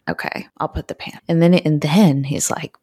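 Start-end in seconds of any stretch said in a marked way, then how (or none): 0.1-1.2 breathless whisper, fast; whispered aside. Retold or imitated. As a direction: whisper it quickly to yourself. Retelling her reply to him.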